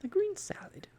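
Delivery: very high, breathy scandalised repeat of "green salad"; the animal's appalled, mocking question; disapproving echo. A narrator's reading. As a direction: sniff at the "green salad" in disbelief. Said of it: "rolling its eyes disapprovingly".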